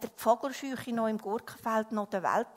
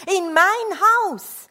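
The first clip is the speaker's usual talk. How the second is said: loud, high, drawn-out shout; incredulous storybook shout; shouted outcry; 9 semitones higher, 14 dB louder, slower than usual. A narrator's reading. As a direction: cry out slowly, stretching each word. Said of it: "schrie er".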